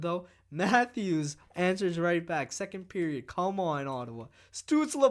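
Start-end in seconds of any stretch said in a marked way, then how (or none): 4.5-5.1 excited shout. Real, not acted. Goal cheer.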